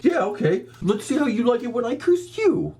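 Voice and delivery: mock the guy's cocky curse.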